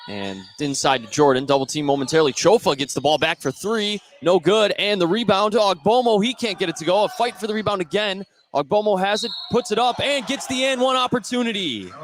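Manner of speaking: rising, building excitement as the shot goes up; play-by-play call. Acted.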